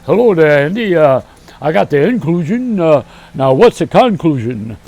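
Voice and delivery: put-on loud old radio comedy duo voice; Amos and Andy routine. Comic mocking the inclusion talk, loud radio-show voice. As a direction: ham up a loud vaudeville exchange. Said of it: "It sounds like Amos and Andy".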